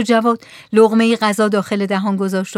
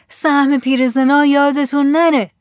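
high, thin-pitched plea from the dark; lightly voiced old woman, pitched up and pleading; feeble voice; 6 semitones higher, 4 dB louder than usual. A narrator's reading. Voice from the darkness reminding the men of old women's share, feebly. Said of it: "صدای ضعیفی از توی تاریکی گفت".

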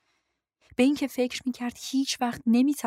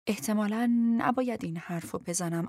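plain reading voice

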